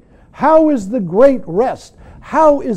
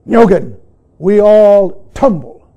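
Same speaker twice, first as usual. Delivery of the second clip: firm teacher's call; 8 dB louder than usual. Retold or imitated. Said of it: "he looked at me and said".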